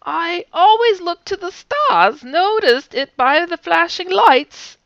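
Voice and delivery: high, slow bad British accent; a hammy attempt at a British accent. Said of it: "I'm such a bad British accent".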